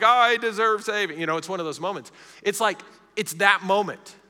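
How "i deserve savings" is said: high, loud pretend wail; mock crying realization. Biker finally realizes he deserves savings, mock-tearful cry. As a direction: imitate a biker tearfully getting it. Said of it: "he starts crying".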